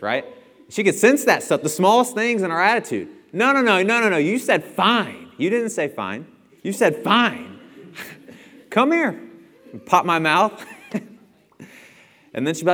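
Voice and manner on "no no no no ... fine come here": high, louder mimicking mother; scolding mom. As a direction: scold like mom catching attitude. Voicing his mom scolding.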